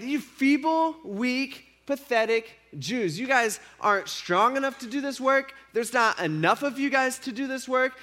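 sneering high-pitched taunt, slow on pathetic; mocking taunt. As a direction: jeer at them as weak and pathetic.

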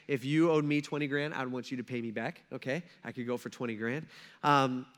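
ordinary talk

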